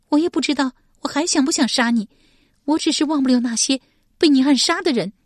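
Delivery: high, fast, tense and loud; blurting out her confusion; agitated outburst. Acted. Shi Pei facing her enemy, agitated and loud.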